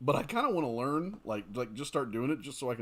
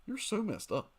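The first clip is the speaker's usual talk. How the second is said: teasing disbelief; 9 semitones higher, 3 dB quieter than usual. Real, not acted. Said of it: "reacting to viewer's chat message".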